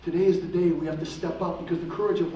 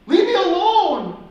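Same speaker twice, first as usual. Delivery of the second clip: much higher and louder, slow cry; screaming; 12 semitones higher, 9 dB louder, slower than usual. Retold or imitated. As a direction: yell it out with everything you have. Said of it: "he screamed out as loud as he could".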